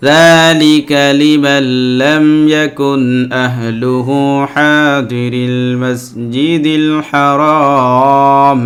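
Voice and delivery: slow, melodic tilawat with long drawn vowels; very slow sung Quran recitation. Acted. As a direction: chant each phrase slowly, holding the vowels. Teacher recites the Quran verse.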